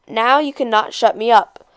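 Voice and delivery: ordinary delivery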